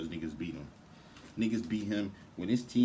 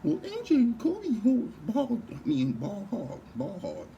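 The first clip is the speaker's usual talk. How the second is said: much higher, slow, whiny mock fan; sneering impression of a hater, pitched way up and dragged out; 6 dB louder, slower than usual. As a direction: ham up a clueless fan defending his take. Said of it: "what the Jock Sniffer said".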